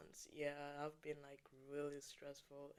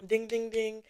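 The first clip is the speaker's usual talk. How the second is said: excited; 7 semitones higher, 17 dB louder than usual. Real, not acted. Announcing a gift.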